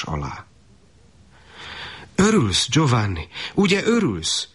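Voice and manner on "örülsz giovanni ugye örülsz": high-pitched, pressing questions; insistent mother coaxing in a high voice; eager urging. A narrator's reading. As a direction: press him for a yes, pitch leaping up and falling. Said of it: "unszolta az asszony".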